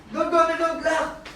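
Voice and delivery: don't go, stay here, called out; calling after her. Real, not acted. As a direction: call her back before she leaves. Calling after a child walking off.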